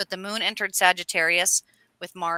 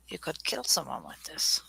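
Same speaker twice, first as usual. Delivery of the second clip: low, hushed retelling of the line; quiet menacing quote; 4 semitones lower, 8 dB quieter than usual. Retold or imitated. Retelling Putin's line to the ring owner, hushed and menacing. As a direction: say it quietly like a veiled threat. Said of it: "he goes".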